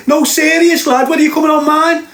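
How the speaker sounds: high-pitched mocking voice of the caller; Marvin. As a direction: send him up as a squeaky little wind-up merchant. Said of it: "this little beaut on the phone".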